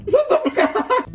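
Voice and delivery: very high chipmunk-like repeat; comic high-pitched replay; squeaky replay. Real, not acted. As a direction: repeat it as a tiny squeaky echo.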